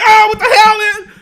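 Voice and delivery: a teacher shrieking in fright; freaked out. Retold or imitated. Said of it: "And the dude freaked out".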